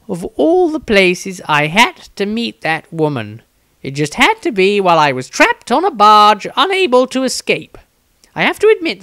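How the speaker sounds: dry, exasperated wizard writing his journal; Maestro's diary voice. Acted. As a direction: read the diary as the fretful wizard himself.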